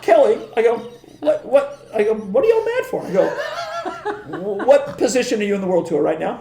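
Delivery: high, laughing mock outrage; laughing tease. Teasing Kelly, laughing through.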